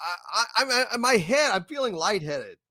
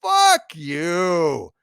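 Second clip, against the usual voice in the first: louder, dismissive fuck you; angry retort; 2 semitones lower, 3 dB louder, much slower than usual. Retold or imitated. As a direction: spit it back at the screen. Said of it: "And it's like".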